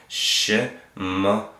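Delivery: Shemot drawn out in separate syllables; sounded out by syllable. Shown as a demonstration. Teacher giving learners the book's name, sounded out. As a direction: break "Shemot" slowly into its syllables.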